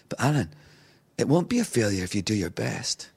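soft, reassuring imitation of a mentor; gentle primary teacher. Friend reassures him about failing, gentle teacher's voice. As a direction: reassure softly, like a kindly primary school teacher. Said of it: "like the best primary school teacher".

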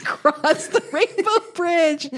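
high, drawn-out mock incredulity through laughter; laughing mockery. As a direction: stretch it out, laughing at the flowery phrase. Repeats the phrase to mock it.

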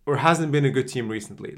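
ordinary talk

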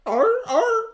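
very high, loud seal yelps; seal bark. Pretend. Host answering as Arthur the seal, piercing bark. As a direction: yelp "art" like an excited seal. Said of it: "he got a seal".

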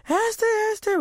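high, wailed calling-out; hammed-up high-pitched distressed call; mock bawling. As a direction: imitate tearfully calling for a lost cat. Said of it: "And I was bawling".

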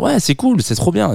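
voix bien plus aiguë, faussement emballée; enthousiasme imité. Retold or imitated. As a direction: joue le gars content de lui, plus aigu. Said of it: "il est vraiment en train de se dire". (pensée imitée d'un ton aigu et ravi)

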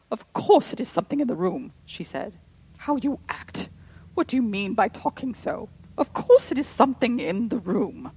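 loud, fierce scolding; furious. A narrator's reading. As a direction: lash out in fury. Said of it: "with a sort of fury".

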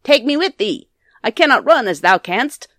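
high, loud frog calling after someone; crying out. A narrator's reading. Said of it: "cried the frog".

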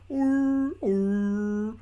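high, drawn-out whale moan; imitated whale call. Host mimics the whale talk heard in the film, as a whale call. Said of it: "you just hear, like".

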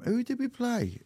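puzzled. Real, not acted. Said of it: "my memory is absolutely shot".

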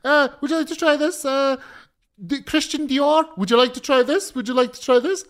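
high, loud, sing-song sales pitch; a duty free seller calling out to a rushing traveller, high and pushy; pushy saleswoman. Retold or imitated. Duty free saleswoman pestering him.

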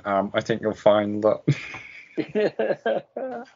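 lightly voiced pedantic nerd, high; a hint of a smug correcting geek; nerdy know-it-all voice. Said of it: "the nerdy voice in my head going".

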